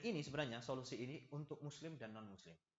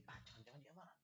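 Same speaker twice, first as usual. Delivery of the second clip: much softer, inward musing; hushed mock suspicion; acted worried thought; 12 dB quieter than usual. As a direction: think it aloud under your breath.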